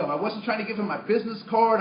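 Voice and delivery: usual speaking voice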